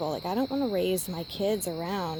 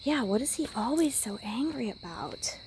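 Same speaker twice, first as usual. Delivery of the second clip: hushed, breathy whisper of someone else's question; 4 semitones higher than usual. Retold or imitated. Friend whispering a question about the angry man. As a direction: whisper it as the other person asking. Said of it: "Someone else asks".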